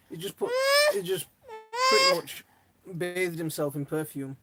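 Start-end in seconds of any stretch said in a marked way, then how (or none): 0.1-2.4 excited squeal. Real, not acted. Excitedly recounting baby in perfume.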